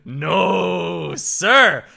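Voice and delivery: drawn-out objection, growing loud; mock indignation. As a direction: build to a punched last word. Acting out his indignant reaction.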